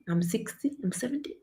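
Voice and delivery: ordinary talk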